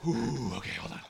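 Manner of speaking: winded, begging a moment; catching breath. Acted. Runner asks friend to wait, out of breath. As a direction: squeeze words out between heavy breaths. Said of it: "I gotta catch my breath".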